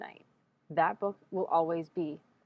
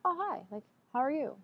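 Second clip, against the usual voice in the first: fake polite greeting; 8 semitones higher than usual. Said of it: "And I'm like".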